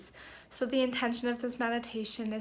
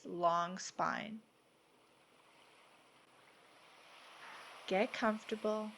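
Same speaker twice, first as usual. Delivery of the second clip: measured, soothing delivery, each phrase given room; guided meditation; 4 dB quieter than usual. Acted. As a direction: guide them gently into peace, unhurried.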